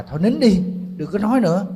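loud, high snapping yell; a husband finally losing his temper; retold angry yell. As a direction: shout it back at the mother-in-law, fed up. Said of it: "Ảnh mới quát lại là".

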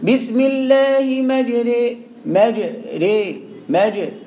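slow, drawn-out chant, مجري bent to a long "majrē"; imala recitation. Shown as a demonstration. Teacher reciting the verse for students, imala on مجري. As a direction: recite مجري with the final vowel between a and i. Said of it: "يقول لك إمالة".

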